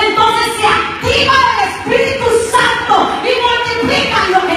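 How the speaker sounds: loud, high, fervent sermon climax; rousing pulpit cry about the Holy Spirit; full-force preaching. Acted. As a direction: preach it out over the music, pushing hard.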